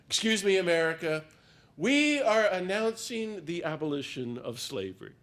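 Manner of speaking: loud, high proclamation; imitating William Lloyd Garrison. Speaker imitates William Lloyd Garrison addressing America, loud proclamation. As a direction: boom it out like a defiant abolitionist announcing to the nation. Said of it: "here's my imitation of William Lloyd Garrison".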